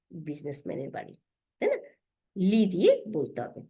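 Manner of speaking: ordinary talk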